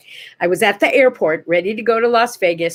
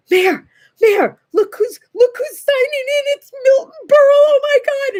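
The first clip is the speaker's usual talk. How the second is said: high, frantic starstruck babble; freaked out; 4 dB louder than usual. Retold or imitated. Fan spotting Milton Berle at the airport, freaking out. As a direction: squeal the news to a friend, much higher and louder. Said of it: "I, like, freaked".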